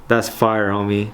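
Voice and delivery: normal talking voice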